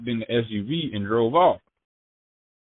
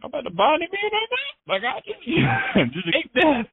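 much higher, strained, half-crying, half-laughing cry; panicked squeal. Retold or imitated. Retelling to the host the moment friends turned on him, panicked high squeal. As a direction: shriek it like you're sure you'll be shot. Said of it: "And I'm like looking at them like".

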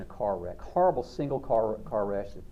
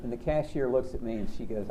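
normal talking voice

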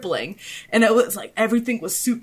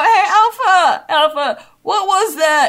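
higher, louder, slow drawn-out call with a laugh; goofy high call; 8 semitones higher, 7 dB louder, slower than usual. Retold or imitated. To friend Alpha on the roof, a slow giddy high-pitched call. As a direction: stretch it out like a giddy stoner. Retelling asking about the weed.

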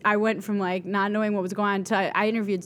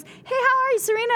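Serena greeting. Retold or imitated. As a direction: gush a warm star's hello. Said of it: "and she goes".

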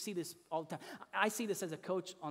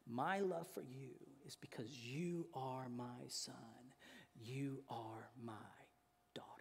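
tender father; 4 semitones lower, 8 dB quieter than usual. Acted. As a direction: speak softly and earnestly to your child. Tender words to his own children.

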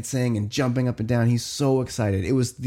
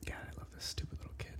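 fond aside whisper; 24 dB quieter, faster than usual. Real, not acted. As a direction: whisper it fast under your breath. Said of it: "whispered aside".

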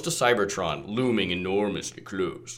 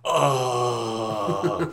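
unearthly voice; much slower than usual. Acted. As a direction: groan it out like a hungry ghost. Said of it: "ghostly voice of Ingester greedily".

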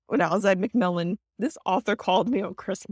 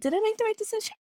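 self-doubting; 13 semitones higher, much faster than usual. Retold or imitated. Publisher questioning her career choice, high-pitched self-doubt. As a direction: squeak out a mock doubt.

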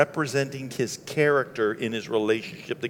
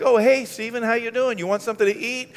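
2 a lightly higher, sweeter wife greeting; 3 play the welcoming wife, pitched up; 1 mock wife voice; 10 semitones higher, 5 dB louder than usual. 4 wife welcoming the stranger husband brought home, cheerful higher voice.

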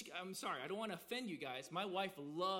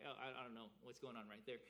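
quick, much softer, lower mumble, backing away from the team; sheepish mumble; 10 semitones lower, 11 dB quieter, faster than usual. Retold or imitated. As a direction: mumble it low and fast, embarrassed. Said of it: "take it off quietly and be like".

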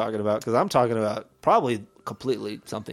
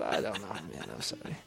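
ordinary talk, nothing marked

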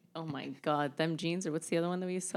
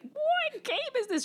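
very high, slow pet voice; excited dog voice; 4 dB louder, slower than usual. Retold or imitated. Owner voicing the dog used as a weight, high silly dog voice. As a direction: squeal it as a baffled, playful little dog. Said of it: "It's more like she's like".

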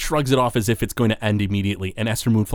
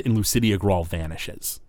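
usual speaking voice

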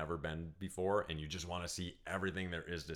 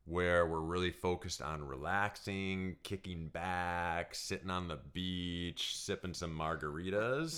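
slowed, lazy delivery; slow relaxed; slower than usual. Shown as a demonstration. Husband painting a lazy beach day, slow and relaxed. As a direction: drawl it like lounging on the beach.

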